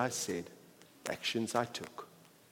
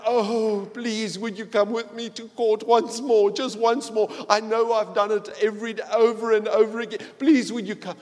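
begging sinner; 8 semitones higher, 14 dB louder than usual. Retold or imitated. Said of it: "I have to beg".

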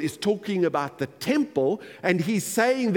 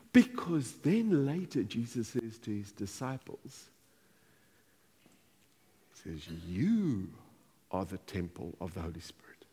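hushed reverence; 10 semitones lower, 9 dB quieter than usual. Real, not acted. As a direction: drop right down to a hush. Hushed emphatic moment of revelation.

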